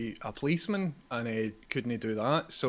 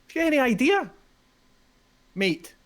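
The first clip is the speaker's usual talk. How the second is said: high, loud exasperated shout; angry shout; 11 semitones higher, 7 dB louder than usual. Acted. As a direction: blast the question out. Referee boss berating manager.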